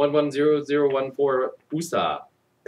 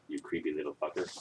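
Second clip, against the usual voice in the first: muttered aside; 7 semitones lower, 13 dB quieter, faster than usual. Real, not acted. Aside about creepy boy.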